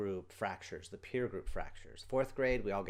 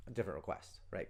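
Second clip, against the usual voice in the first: ordinary talk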